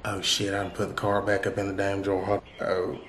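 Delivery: low, soft mutter to oneself; half-voiced grumble, off to the side; grumbling aside. Real, not acted. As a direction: mumble it under your breath, annoyed. Grumbles to himself about the card.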